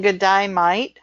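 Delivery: imitated Australian greeting; Australian g'day with broad vowels; Australian accent. Shown as a demonstration. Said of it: "We all know that one".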